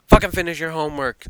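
mock angry scolding. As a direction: scold the listener as a joke.

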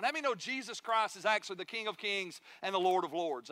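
ordinary delivery

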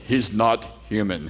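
a deep, weary mutter of disbelief; low, slow Russian voice. Retold or imitated. Preacher voicing the beaten Russian boxer, low and slow.

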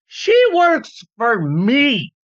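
slow, huffy boss grumbling; jealous boss voice. Retold or imitated. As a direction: drag it out like a petty boss.

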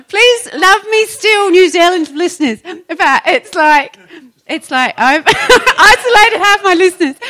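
high, loud, laughing through the words; helpless laughter breaking into loud speech; laughing plea. Real, not acted. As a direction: plead with your audience while cracking up. Jokey plea to offended listeners, laughing.

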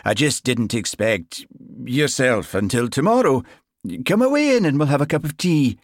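put-on flustered Highland accent. A narrator's reading. Sergeant inviting new constable in, flustered Highland accent. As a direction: cover the awkwardness in a broad Highland accent. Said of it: "His Highland accent always grew more pronounced when he was flustered".

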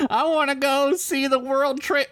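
high put-on tourist voice; hammed-up high-pitched sightseer; mocking eager tourist. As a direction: whine it high, then drop on the realization. Mocks the friend's tourist wishes.

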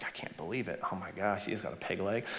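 hushed, breathy disbelief; whispered amazement. Acted. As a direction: whisper it in awe. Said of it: "the sailor's like".

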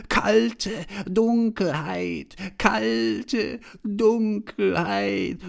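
very high, drawn-out, loud mock call; mocking, laughing receptionist. A narrator's reading. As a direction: crow each word slowly, high and loud. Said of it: "lachte auch die Rezeptionistin und sagte".

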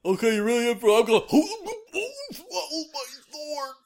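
high goofy dog voice; squeaky, silly sword dog voice; Zacian impression. Still voicing the sword dog.

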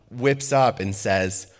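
ordinary talk, nothing marked

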